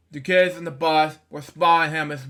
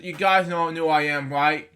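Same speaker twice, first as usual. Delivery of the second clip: usual speaking voice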